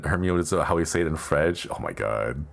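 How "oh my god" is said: usual speaking voice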